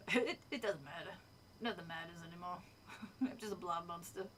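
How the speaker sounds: low, soft cartoon-character impression; Carl. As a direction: mumble it low, as if nothing matters. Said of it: "Oh, is Carl from Aqua Teen?".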